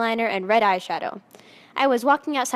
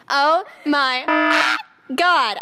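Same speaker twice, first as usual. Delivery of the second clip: louder excited shriek; screaming; 4 semitones higher, 4 dB louder, much slower than usual. A narrator's reading. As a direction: squeal with fangirl delight. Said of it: "I screamed".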